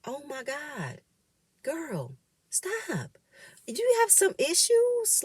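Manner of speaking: soft, high disbelieving aside; incredulous. Real, not acted. Host reacts to Amina's outburst, stunned and high. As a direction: gasp it out, shocked and high. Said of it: "reacting to Amina's outburst".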